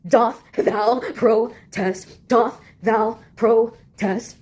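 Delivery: very slow, stretched mock-Shakespearean line; mock old-English drawl. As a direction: drag it out like a hammy stage actor. Archaic mock-Shakespeare phrasing.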